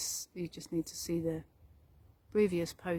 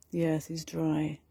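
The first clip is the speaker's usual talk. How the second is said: guided meditation; 7 dB louder than usual. Acted. Meditation teacher guiding listeners through meadow and forest, guided meditation. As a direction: lead them unhurriedly through the imagery.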